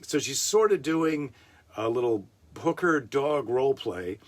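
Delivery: ordinary delivery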